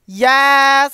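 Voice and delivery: a loud, high cheer; excited yes. Real, not acted. Cheering as the favorite video starts, excited. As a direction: shout it with delight.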